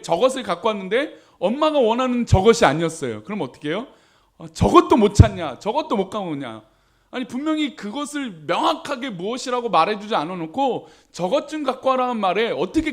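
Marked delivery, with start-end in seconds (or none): none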